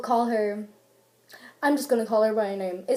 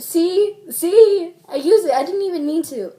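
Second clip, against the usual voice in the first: loud, high exclamation; excited self-catch; 7 semitones higher, 10 dB louder than usual. Real, not acted. Caught using the word by accident, loud. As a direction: burst out as if proving a point.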